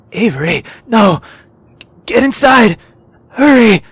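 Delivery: loud panicked yelling; urgent warning shout. Acted. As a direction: yell at her to get away. Laundry warns her to flee.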